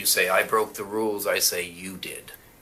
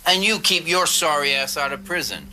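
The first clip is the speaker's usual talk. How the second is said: angry outburst; 8 semitones higher than usual. Acted. Lawyer snapping at his partner in crime, angry and higher. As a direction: voice jumps higher in anger. Partners quarrel over the jobs.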